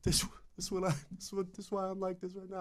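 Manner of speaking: high, soft imitation of crying; tearful explanation. Crying kid explains himself to the band, tearful. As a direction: put on a small, weepy voice. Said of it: "he's crying so hard".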